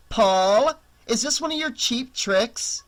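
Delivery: high-pitched accusing call. A narrator's reading. Annie suspects Paul is hiding outside, calling out. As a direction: call the name loudly, then question the prankster.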